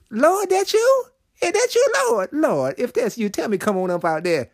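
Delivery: high, eager voice calling across the water; Peter calling out. Acted. Preacher voicing Peter calling to Jesus across the waves, high and eager. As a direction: play Peter straining to see if it's Jesus.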